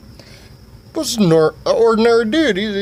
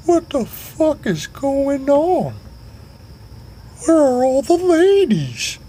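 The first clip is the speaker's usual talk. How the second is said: put-on high, slow drunken voice; hammed-up drunk buddy; drunk high whine; 11 semitones higher, slower than usual. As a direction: slur it out like a confused drunk, way up high. Drunk Sugar Tits, high slow.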